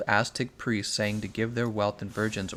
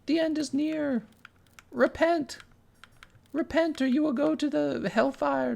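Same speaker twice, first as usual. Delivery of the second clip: much higher, screechy end-of-the-world prophet voice; mock doomsday preacher. Streamer mocking an activist as a doomsday preacher, shrill mock voice. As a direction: shriek the doom like a street preacher. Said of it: "listening to somebody scream".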